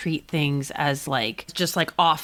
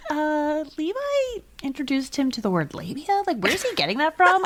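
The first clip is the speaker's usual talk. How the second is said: concerned mom; 9 semitones higher than usual. Retold or imitated. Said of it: "she was like".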